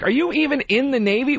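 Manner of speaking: high, mocking disbelief; incredulous retort. Asking the Navy woman back in mock disbelief. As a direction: throw the question back at her. Retold argument with lady.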